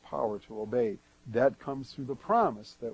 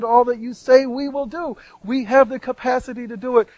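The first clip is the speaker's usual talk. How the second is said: high, fast, confident crowd's promise, hammed up; mock boastful people; 9 semitones higher, 8 dB louder than usual. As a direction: imitate the people's naive pledge, higher and louder.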